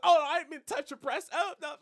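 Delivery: high, loud flustered apology; embarrassed. Retold or imitated. Retelling a character touching a breast by accident, embarrassed. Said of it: "he was like, "Someone embarrassed".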